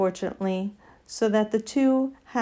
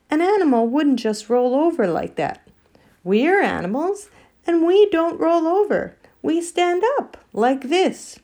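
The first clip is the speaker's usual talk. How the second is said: higher, louder bear, proudly demonstrating; indignant bear; 8 semitones higher, 5 dB louder than usual. Acted. Bear showing the animals how they stand, indignant. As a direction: argue as a bear, pitch climbing with each point.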